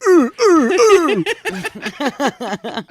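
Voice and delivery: put-on drunk seal barking grunts; comic slurred seal noises. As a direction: imitate a tipsy seal in short grunts. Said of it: "I really need you to sound like a drunk seal".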